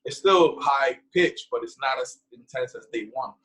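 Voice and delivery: normal talking voice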